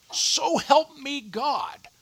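drawn-out, high-pitched courtroom oath; mock solemn oath.

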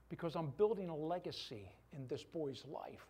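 normal talking voice

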